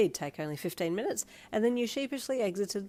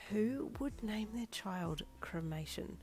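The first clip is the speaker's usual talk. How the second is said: troubled thought; 7 dB quieter than usual. A narrator's reading. Narrated troubled inner thought.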